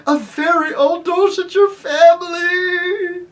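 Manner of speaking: high, loud, slow self-pitying wail. Acted. Uncle Andrew wailing in the dark, high and slow. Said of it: "it was wailing".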